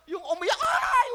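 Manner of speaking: loud, high laughing wail; mock child's cry. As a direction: ham up a child suddenly bawling. Child suddenly crying in study. (kunwaring iyak ng bata)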